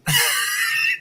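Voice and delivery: high breathless laugh; burst of laughter. Real, not acted. Host cracking up while telling the story. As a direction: crack up at the memory.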